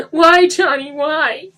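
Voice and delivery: high, loud wailing sob; bawling. Acted. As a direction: bawl in grief. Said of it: "Denny bawled".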